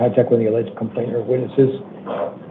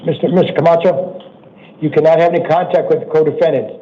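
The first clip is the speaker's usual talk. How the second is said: raised voice, calling across the room; 4 semitones higher, 5 dB louder than usual. Real, not acted. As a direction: call out to be heard at a distance. Judge calls to distant defendant.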